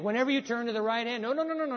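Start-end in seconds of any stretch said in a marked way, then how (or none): 1.2-1.8 high, rapid-fire run of no's; urgent warning. Retold or imitated. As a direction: jump up high to stop the driver. Acts the guide's urgent warning.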